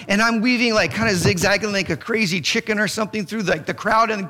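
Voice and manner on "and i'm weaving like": normal talking voice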